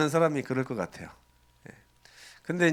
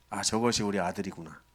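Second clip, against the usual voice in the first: tender recognition; 6 semitones lower, 8 dB quieter than usual. Acted. Father recognises returning son.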